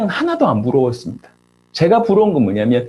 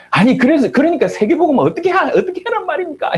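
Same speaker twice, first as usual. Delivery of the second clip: high-pitched, exasperated demand; retold outburst from a frustrated younger friend; angry protest; 10 semitones higher than usual. Younger friend confronting his mission leader, angry protest. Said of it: "화를 내면서".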